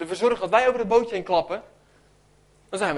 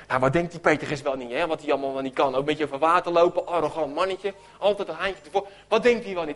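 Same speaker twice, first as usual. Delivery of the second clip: lower, sneering grumble; mocking gossip; 6 semitones lower than usual. Disciples judge Petrus in the boat, lower sneering gossip. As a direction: badmouth him behind his back. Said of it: "ze beginnen te blappen".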